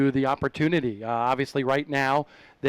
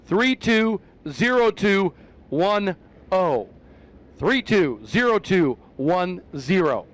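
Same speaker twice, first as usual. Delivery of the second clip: PA announcer voice; 3 semitones higher than usual. Pretend. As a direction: read the winning number over the PA. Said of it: "I'm gonna get my P A voice on".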